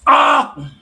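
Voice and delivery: sudden loud yell; yelling across the room. Real, not acted. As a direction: shout it out in surprise. Sudden yell during game.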